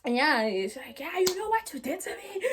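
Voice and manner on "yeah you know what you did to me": high-pitched mock accusation; retold accusation. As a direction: mimic the raving friend without shouting. Retelling possessed friend's accusation.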